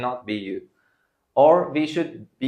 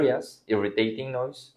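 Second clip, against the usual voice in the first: usual speaking voice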